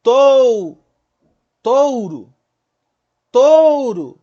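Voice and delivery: "touro" forte e agudo, com o O sustentado; O que dura mais. Shown as a demonstration. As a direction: estique o O de "touro", caindo no U. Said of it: "a mais forte vai ser a que vai durar mais". ("touro" enfático, vogal longa e decrescente)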